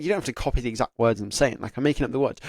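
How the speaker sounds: usual speaking voice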